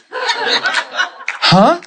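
high, rising squeak of disbelief; astonished huh. Retold or imitated. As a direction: squeak a mock-amazed huh. Acts out disbelief at coworker's answer.

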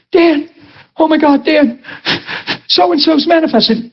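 high, frantic phone voice; a frightened friend on the line; panicked caller. Retold or imitated. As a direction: call for help in a panic.

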